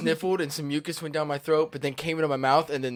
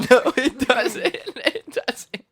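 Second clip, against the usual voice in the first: laughing; 10 semitones higher, 5 dB louder, much slower than usual. Real, not acted. Laughing through the burn.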